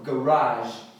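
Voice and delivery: garage said ga-RAZH with French zh; French-sounding garage. Shown as a demonstration. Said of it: "como se fosse um francês falando". (garage com sotaque francês, garáj)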